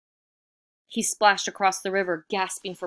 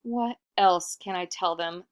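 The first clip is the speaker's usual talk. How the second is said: plain reading voice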